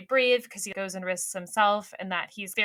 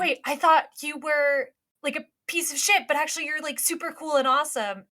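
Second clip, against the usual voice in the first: high-pitched imitation of astonished realisation; mock amazement; 8 semitones higher than usual. Crewman revising his opinion of his captain, mock amazement. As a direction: play someone suddenly won over, pitched up.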